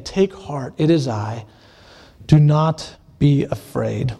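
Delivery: low, slow and steady reassurance; deep, slow comforting voice of Jesus; calm reassurance. Acted. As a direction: settle the frightened men gently. Jesus calming terrified disciples.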